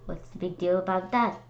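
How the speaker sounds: ordinary delivery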